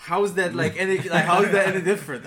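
high, loud incredulous retort; retold indignation. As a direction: challenge him, acting out your outrage. Retold indignant retort to security manager.

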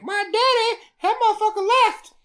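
high, loud put-on voice; hammed-up urban complaint, way up high; urban-style Meg. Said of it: "Why are you talking like this?".

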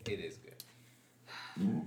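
slow, whispered insistence; hushed. Real, not acted. Urging friend to catch up on the show, hushed. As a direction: whisper it slowly, drawn out.

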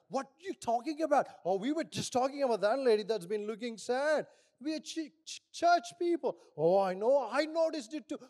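loud, high-pitched gossiping churchgoers; hammed-up eagerness to join the gossip; excited mock gossip. Acts out gossip spreading among members.

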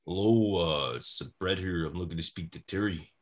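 low, subdued Canadian wrestler voice; Bret Hart impression. Wrestler phoning a colleague's house, low subdued impression. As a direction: keep it low and understated, deadpan. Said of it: "fairly decent Bret impression".

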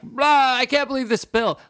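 high, loud comic roar of outrage; mock angry outburst. Dad acting out exploding over a bill, mock rage. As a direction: explode theatrically, voice jumping way up. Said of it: "I don't explode like".

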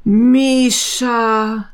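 put-on thin man amazed to see his old friend. A narrator's reading. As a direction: gasp out the friend's name in wonder. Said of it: "staunte der Dünne".

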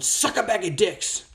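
loud, high, slow blurted insult; slow, high road-rage yell. Retold or imitated. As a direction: yell it out the car window at the other driver. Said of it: "I just yelled out".

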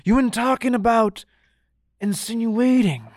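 a hint of a pompous wrestler drawing out the big word; host mimics a wrestler's wordy promo, lightly voiced; mock Keith Lee. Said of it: "he's like".

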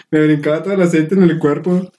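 ordinary delivery

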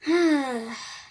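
big heavy exhale; loud weary sigh. Acted. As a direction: sigh like it's a chore. Cupcake goes to open door.

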